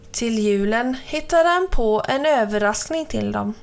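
ordinary delivery, nothing marked

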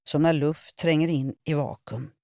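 plain reading voice

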